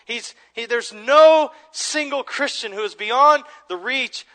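rising, loud preacher's cry, slowed on the key words; preaching at full force. Acted. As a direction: lift the room with rising conviction.

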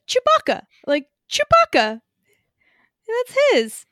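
high, loud and indignant; excited outburst. Real, not acted. Insisting the Falcon is Chewbacca's.

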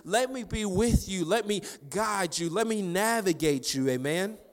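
high, forceful preaching; fervent, insistent sermon delivery. Real, not acted. Preaches at high intensity after story.

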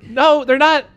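laughing disbelief, shouting the answer; incredulous shout. Real, not acted.

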